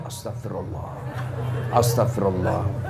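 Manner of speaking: 1 hushed istighfar. Shown as a demonstration. Young man seeing pretty girl.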